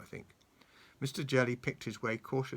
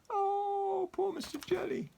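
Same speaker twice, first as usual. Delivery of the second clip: very high sympathetic cry; mock pity; slower than usual. Real, not acted. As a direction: feel sorry for poor Mister Jelly. Grandmother pities the character.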